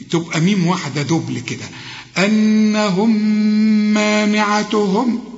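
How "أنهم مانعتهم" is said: أنهم مانعتهم run together with one long hummed "mm"; merged meem. Shown as a demonstration. Teacher showing two meems merged, أنهم مانعتهم slowly hummed. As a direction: join the two words on a held nasal meem. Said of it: "الميم دي بتضغم في الميم دي".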